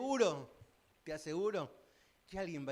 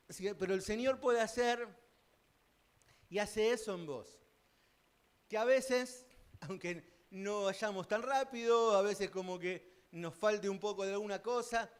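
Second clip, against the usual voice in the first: preaching at full force; 5 semitones higher, 6 dB louder than usual. Acted. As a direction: preach it out to the congregation. Predica con fuerza creciente. (predicación enérgica y creciente)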